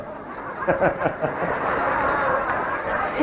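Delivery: soft unvoiced laugh; a quick airy giggle; breathy laugh. A flustered breathy laugh at Sandhya's question.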